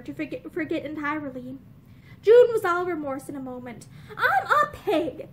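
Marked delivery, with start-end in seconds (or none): 4.2-5.3 slow, higher self-disgust; disgusted with herself. A narrator's reading. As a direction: spit it at herself, slowly. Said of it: "she said disgustedly".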